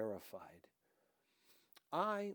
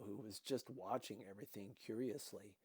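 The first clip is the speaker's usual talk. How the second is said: plain reading voice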